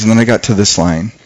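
ordinary talk

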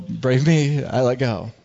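ordinary delivery

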